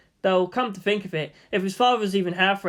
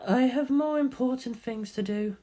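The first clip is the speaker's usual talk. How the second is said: high, cold female voice; Ritsuko voice; 6 semitones higher than usual. Acted. Ritsuko dismisses the others, high cold voice. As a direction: brush them off coolly. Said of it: "she said".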